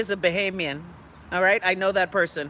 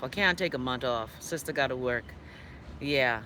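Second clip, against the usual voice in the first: resigned sigh; 7 semitones lower, 8 dB quieter than usual. Real, not acted. Resigned about vacation.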